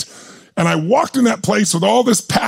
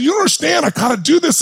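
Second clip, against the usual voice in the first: desperate plea; 9 semitones higher than usual. Retold or imitated. Pleading with the banker to understand, pitched high. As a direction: beg her to get it.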